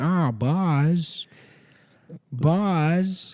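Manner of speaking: mock a friend's failed Tom Hanks; bad Tom Hanks. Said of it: "He keeps going".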